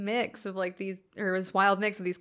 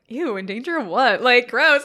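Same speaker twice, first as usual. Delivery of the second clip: disgusted; 2 semitones higher, 8 dB louder than usual. Retold or imitated. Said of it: "this man is like".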